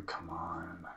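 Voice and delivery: very soft muttering to himself; hushed, impatient repeated mutter. Acted. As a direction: urge the device on under your breath. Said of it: "you hear him muttering".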